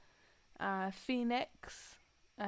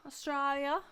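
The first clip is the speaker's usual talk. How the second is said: attempted Australian accent; 5 semitones higher than usual. Shown as a demonstration. Podcast host repeats the country's name in a clumsy Australian accent. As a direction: have a bad go at an Australian accent. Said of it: "try your Australian accent one more time".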